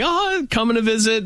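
high, sing-song visitor voice; high mock visitor. Mimicking cheerful hospital visitors, high sing-song. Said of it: "people were like".

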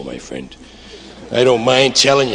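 ordinary talk, nothing marked

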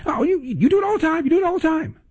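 very high-pitched mock sputtering; flustered caller. Host imitates caller dodging his challenge, mock flustered. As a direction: squeal the vague accusation like a cornered caller. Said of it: "the person who does this".

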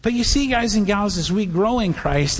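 normal talking voice

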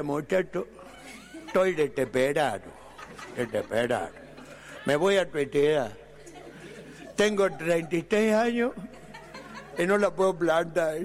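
lisping through the words; despairing speech defect voice. Retold or imitated. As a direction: mangle the consonants like Miguelito. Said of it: "Hablaba arte".

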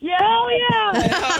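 high, excited hell yeah. Real, not acted. Winner cheering about wrestling tickets, high and excited. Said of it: "a hell yeah or something".

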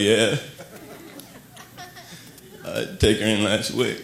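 a worried Texas guy talking like his truck is family; Texas truck owner. Retold or imitated. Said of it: "Dude's like".

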